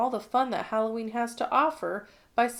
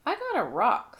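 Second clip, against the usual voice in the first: ordinary delivery, nothing marked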